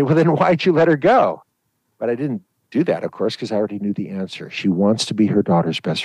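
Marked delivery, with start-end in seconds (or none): 0.0-1.4 higher, louder mock exasperation; exasperated retort. Doctor imagines scolding the mother, exasperated higher retort. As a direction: snap back in disbelief. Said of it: "wanna grab mom and shake her and say".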